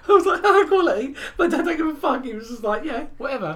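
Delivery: high, loud words broken by laughter; laughing through words. Real, not acted. Laughing to co-host about his dad's burn. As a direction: keep cracking up mid-sentence.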